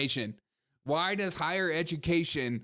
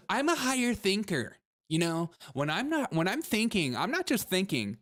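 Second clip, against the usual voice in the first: high, swooping haughty singsong; mock pompous thinker; 6 semitones higher than usual. Host plays a gender studies scholar boasting, pompous mockery. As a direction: preen in an exaggerated high lilt.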